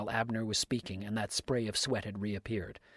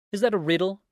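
high, loud incredulous question; cried out; 9 semitones higher, 9 dB louder, faster than usual. A narrator's reading. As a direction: throw the question back loudly. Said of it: "cried Dix".

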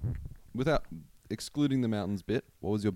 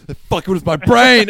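loud, high panicked outburst; panicked shout; 10 semitones higher, 13 dB louder than usual. Retold or imitated. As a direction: yell it like you just realised. Mock panicked realization.

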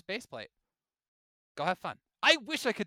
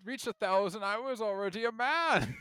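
put-on slow, higher crotchety old man; 5 semitones higher, 6 dB louder, slower than usual. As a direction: grumble like a proud old-timer. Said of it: "pull out the old man card".

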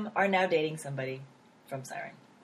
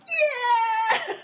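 high, loud, drawn-out victory yell; triumphant cheer; 5 dB louder than usual. Retold or imitated. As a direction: cheer like your team just won. Acts out cheering at award win.

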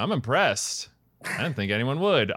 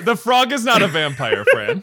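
laughing, louder, Fran jumps up high; laughing protest aimed at Fran; 6 semitones higher, 6 dB louder than usual. Real, not acted. Laughing correction of Fran's guess.

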